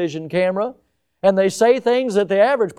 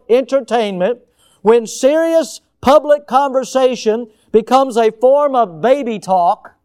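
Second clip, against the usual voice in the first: emphatic quote reading; 5 semitones higher, 7 dB louder than usual. Acted. Pastor reading Postman to his congregation, emphatic and rising. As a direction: lean into each clause of the quote. Preacher reads the quote with force.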